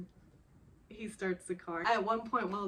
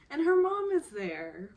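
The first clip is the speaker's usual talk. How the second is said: louder, higher alarmed reveal; surprised; 6 semitones higher, 8 dB louder than usual. Real, not acted. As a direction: announce it with a jolt. Shocked reveal of mom.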